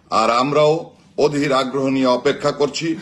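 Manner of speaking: usual speaking voice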